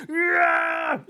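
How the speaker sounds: high, loud rage scream; sudden high-pitched outburst of mock fury; game rage yell. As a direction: yell it like a gamer blowing up at a maddening game. Imitates raging at a game.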